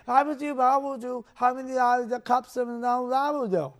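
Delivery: a hint of a small child calling Daddy. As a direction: mimic an eager small son. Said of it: "And he'll say".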